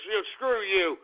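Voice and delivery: ordinary talk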